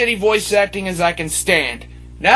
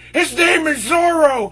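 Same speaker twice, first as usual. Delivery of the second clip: shouting his name is Zoro, much higher; angry fan shout; 6 semitones higher than usual. Retold or imitated. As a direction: correct them like an outraged fan. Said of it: "send me hate mail saying".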